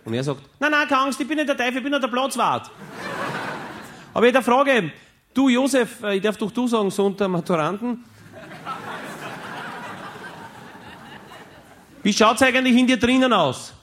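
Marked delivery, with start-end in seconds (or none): 0.6-4.9 high eager groundskeeper pestering Josef; Platzwart-Stimme. Acted. Platzwart redet mit Josef. 5.3-6.0 "Du, Josef" much higher and louder, falling on "Du". Acted. Platzwart spricht Josef an. 6.0-13.7 high eager groundskeeper pestering Josef; Platzwart-Stimme. Acted. Platzwart redet mit Josef.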